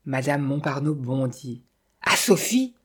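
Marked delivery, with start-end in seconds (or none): 2.0-2.7 high, indignant outburst; acted outrage, leaping up in pitch; roared question. A narrator's reading. Widow learning Sophie inherits, roaring in disbelief. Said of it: "rugit-elle".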